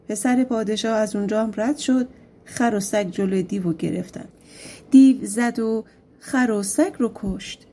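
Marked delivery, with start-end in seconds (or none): none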